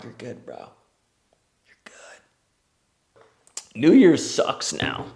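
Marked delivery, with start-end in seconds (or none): 0.0-0.7 much softer, low-key "You're good, bro"; hushed reassurance. Retold or imitated. Bystander hushing the yelled-at guy, quietly. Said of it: "They're like". 1.7-3.2 breathy whisper of "You're good". Retold or imitated. Bystander reassuring the parker, in a whisper. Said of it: "They're like".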